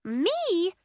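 high, indignant question; affronted squeak of protest. A narrator's reading. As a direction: object in disbelief. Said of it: "Nori protested".

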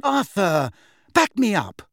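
high, loud appeal to a husband; urgent demand. A narrator's reading. Rounding on husband for support.

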